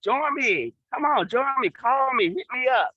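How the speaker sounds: excited invitation. Real, not acted. Calling the audience to join.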